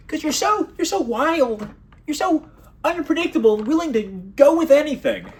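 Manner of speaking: high, fawning chatbot flattery with a laugh; mocking AI voice. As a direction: gush like a sycophantic bot. Mocking the AI's flattering answer.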